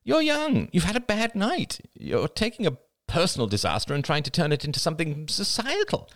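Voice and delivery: put-on young woman character voice. Crystal's niece pleading with her aunt, character voice. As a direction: give the character her own bright young voice.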